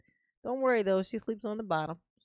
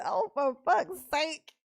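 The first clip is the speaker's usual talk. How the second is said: quoting exasperation; 7 semitones higher, 5 dB louder than usual. Retold or imitated. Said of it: "Deborah said".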